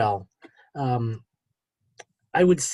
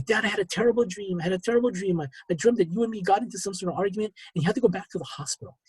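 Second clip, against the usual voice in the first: higher, hurried plaintive retelling; imitated sobbing son; 6 semitones higher, faster than usual. Son telling dad about a terrible dream, lightly imitated distress. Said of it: "And he's sobbing and sobbing".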